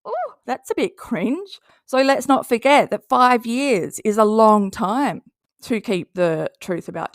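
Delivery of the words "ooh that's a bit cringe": cringing reaction. Retold or imitated. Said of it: "where you kind of go a little bit".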